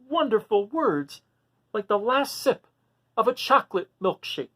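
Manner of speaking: long for the ending like a last sip; pleading dragon voice. Acted. Reading aloud to kids, voicing Duncan's pleading wish.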